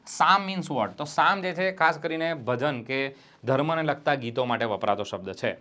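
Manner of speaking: ordinary talk, nothing marked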